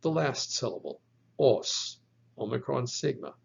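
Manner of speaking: usual speaking voice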